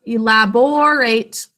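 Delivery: ELABORATE slowly, with a full, lengthened OR in the third syllable; sounded out. Shown as a demonstration. Teacher points out the third syllable, ELABORATE with stretched OR. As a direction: bring out the "or" in ELABORATE. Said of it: "In the third syllable".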